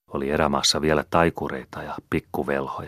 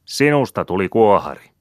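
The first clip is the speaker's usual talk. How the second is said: higher, loud call with sinusta hit hard; loud, high pointed call; shouting; 6 semitones higher, 6 dB louder than usual. A narrator's reading. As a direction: shout it straight at the boy. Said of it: "ja huusi hänelle".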